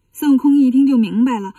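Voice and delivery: plain reading voice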